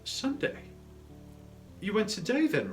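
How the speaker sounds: high-pitched surprised query; questioning wife. Acted. Wife asks Bob about his visit, surprised. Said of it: "said his wife".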